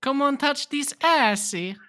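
high, loud mock-European seduction; hammed-up foreign lover accent; put-on European accent. Shown as a demonstration. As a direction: beckon in a silly European accent. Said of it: "I will talk like this".

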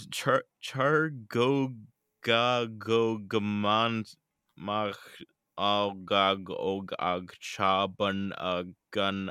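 slow, loud, halting stab at "Chargogagamach"; butchered attempt. Real, not acted. Tries to read the lake name aloud, slow and stumbling. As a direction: sound out "Chargogagamach" syllable by syllable, stumbling. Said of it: "I am going to butcher the hell out of this".

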